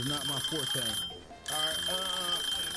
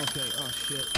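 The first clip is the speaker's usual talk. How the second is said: ordinary delivery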